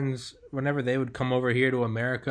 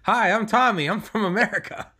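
higher, louder mock greeting; pretend self-introduction; 8 semitones higher, 4 dB louder than usual. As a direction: introduce yourself like an eager American abroad. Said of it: "Just be like".

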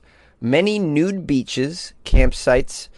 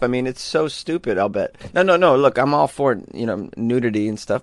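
normal talking voice

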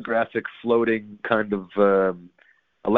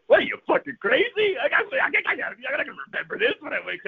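much higher, frantic and fast; incredulous excited yell. Retold or imitated. Dreamer yelling back at the giant, frantic and high. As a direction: blurt it out, half laughing at the absurd ask.

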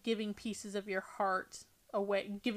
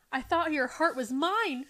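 hurt reproach; 5 semitones higher, 6 dB louder than usual. A narrator's reading. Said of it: "she said".

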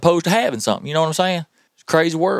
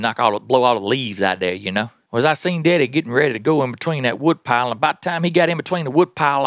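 normal talking voice

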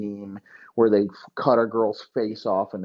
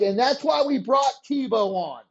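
higher, eager coach laying out a crazy plan; a lightly raised, wheeling-dealing coach; scheming coach voice; 9 semitones higher than usual. Retold or imitated. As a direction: sell the scheme like a hyped head coach. Voicing Urban Meyer pitching plans.

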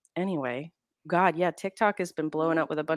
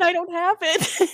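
exasperated whine; 10 semitones higher than usual. Retold or imitated. Exasperated reply to commenters.

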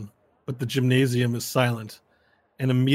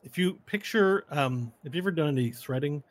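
normal talking voice

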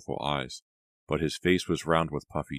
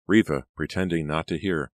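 usual speaking voice

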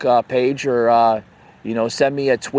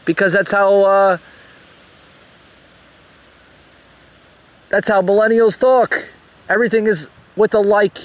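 heated rant; 6 semitones higher than usual. Real, not acted. Ranting about her speech.

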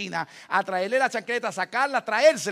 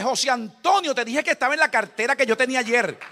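raised wife's reply; wife answering back; 5 semitones higher, 8 dB louder than usual. Retold or imitated. Wife snaps back at husband over the card, loud. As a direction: answer him sharply, loud.

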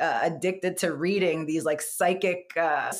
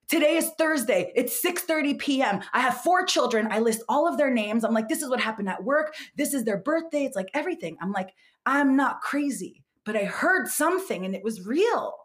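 urgent insistence; 7 semitones higher than usual. Retold or imitated. Proving sanity to wife.

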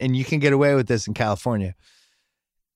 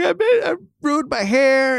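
much higher, whiny imitated sob; mock crying kid; 12 semitones higher than usual. Son crying over haircut.

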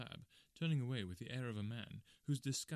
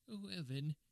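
high, uneasy little-man voice; high-pitched, sheepish concession; reluctant little man; 7 semitones higher than usual. Acted. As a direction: give in nervously.

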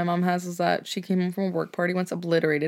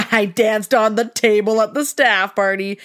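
put-on slow, louder giggly drunk boast; tipsy proud mom; 3 semitones higher, 5 dB louder, slower than usual. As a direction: brag like a happily drunk mom. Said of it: "she just goes".

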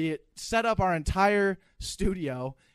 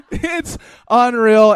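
much louder, slow "unreal" through laughter; loud laughing disbelief; 5 semitones higher, 11 dB louder, much slower than usual. Real, not acted. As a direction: belt it out laughing, can't believe it. Laughing over the liquor story.

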